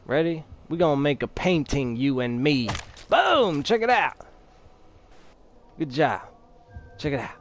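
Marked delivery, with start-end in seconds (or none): 3.1-4.2 excited showoff. Acted. Showing off a kill.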